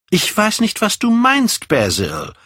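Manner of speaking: high-pitched, agitated exclamation; crying out. A narrator's reading. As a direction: cry out in bewilderment, pitch leaping up. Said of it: "rief er aus".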